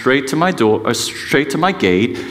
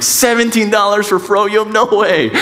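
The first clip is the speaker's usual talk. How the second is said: higher, incredulous scoffing at the price; mock outrage; 8 semitones higher than usual. Dad scoffing at the kiosk price of froyo, mock outraged. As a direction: balk at the price like a cheapskate dad.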